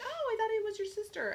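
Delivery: high-pitched mock realization; imitated surprise. Mom learning which daughter called, imitated surprise.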